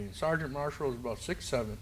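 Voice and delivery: usual speaking voice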